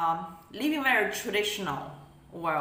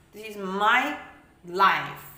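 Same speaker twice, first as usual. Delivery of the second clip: louder, pushing each word out; loud insistence; 6 dB louder, slower than usual. Acted. Asserting her life to the family, louder. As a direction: insist on it with force.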